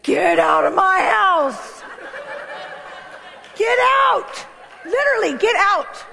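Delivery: high, laughing yell to get out; mock shout. Woman to her future husband, laughing shout of get out. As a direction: throw him out of the house, half laughing. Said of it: "and I went".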